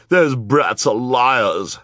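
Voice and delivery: high, loud, drawn-out scorn; snapping. A narrator's reading. Esme denouncing the children, loud drawn-out snappish voice. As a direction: snap it out, stretching the words. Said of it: "Esme snapped".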